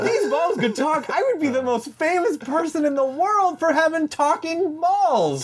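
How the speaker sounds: very high, laughing exclamation; high comic boast. Acted. Comic bragging about having talking balls, high exclamation. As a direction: crow it at the top of your range.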